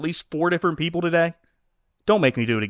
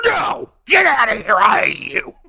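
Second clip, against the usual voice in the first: shouted, higher and louder, hammed-up rage; mock furious shout; 7 semitones higher, 7 dB louder than usual. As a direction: yell it at the kid like a hammed-up angry dad. Acting the father snapping at daughter.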